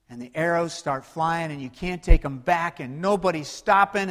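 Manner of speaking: ordinary talk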